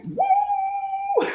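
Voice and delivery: high-pitched "woo" whoop. Retold or imitated. Storyteller reacts to hearing Brooklyn, whooping. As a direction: let out a high, drawn-out whoop. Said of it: "right there, go".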